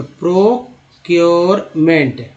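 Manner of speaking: प्रोक्योरमेंट as three slow chunks; pro, cure, ment: प्रोक्योरमेंट with gaps between; broken into parts. Shown as a demonstration. Teacher showing learners the parts, प्रोक्योरमेंट slowly split. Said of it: "तोड़ तोड़ के कैसे पढ़ेंगे".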